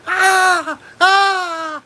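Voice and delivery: high mock moan; hammed-up high cry of ecstasy; mock screaming moan. Host imitating the screaming woman, high mock moans. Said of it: "screaming like".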